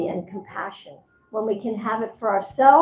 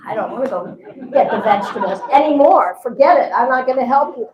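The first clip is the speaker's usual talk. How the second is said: a louder, grumbling huff, half laughing; mock-sulky refusal, a bit louder; sulky husband; 6 dB louder, faster than usual. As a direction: play the fed-up husband throwing in the towel. Husband sulks after being yelled at.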